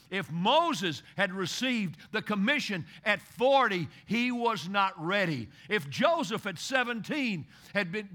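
loud, ringing pulpit preaching; preaching at full force. Acted. Pastor rousing the congregation about Lazarus, full-force preaching. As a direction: drive it home to the congregation.

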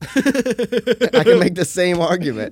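arguing with a friend about wrestling, slow; slow retort. Real, not acted. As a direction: drag it out pointedly.

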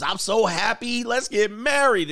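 high, slow, gushing mock excitement; mock giddy. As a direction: play the lovestruck fool proposing on a high. Mocking giddy couple.

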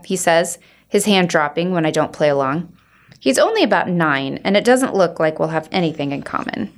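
ordinary delivery

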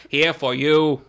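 loud, odd robot voice; mock robot JFK. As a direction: keep the robot bit going. Continuing robot JFK bit.

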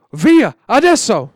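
loud, drawn-out order to go now; urgent command. Acted. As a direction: push them out the door. Father orders boys to leave.

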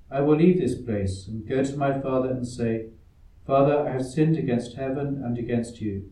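ordinary talk

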